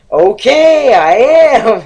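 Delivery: put-on high, loud kid's whine; a kid yelling back at his mom; imitating a whiny kid. Kid in lobby answers his mom.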